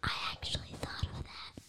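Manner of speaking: soft whisper. Real, not acted. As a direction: whisper it quickly. Child whispers aside.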